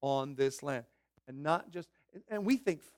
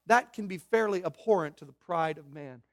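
usual speaking voice

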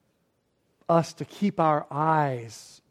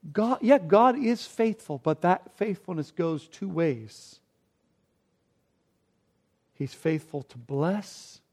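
usual speaking voice

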